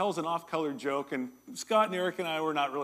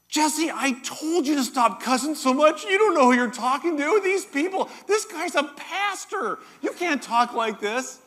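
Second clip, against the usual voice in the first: much higher, louder mock scold, slowing at the end; buddy scolding; 10 semitones higher, 7 dB louder than usual. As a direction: play the buddy chewing out his friend, voice up high. Buddy scolds Jesse for cussing.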